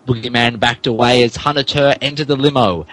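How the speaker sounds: normal talking voice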